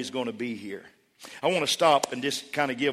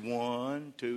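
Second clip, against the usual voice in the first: acted slow, low count; 5 semitones lower, 12 dB quieter than usual. Abraham starting to count the stars, slow counting. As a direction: count softly, drawing out each number. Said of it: "A little quicker than that".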